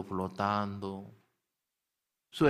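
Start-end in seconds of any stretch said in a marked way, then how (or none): 0.0-1.1 "flotando" drawn out slow and softer, like drifting away; slow, soft echo. Shown as a demonstration. Teacher picturing the basket drifting on the Nile, slow soft echo. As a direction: let "flotando" float off slowly and quietly.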